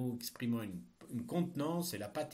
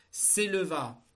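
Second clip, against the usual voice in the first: dictating; 6 semitones higher, 7 dB louder, slower than usual. Shown as a demonstration. Teacher dictating a text.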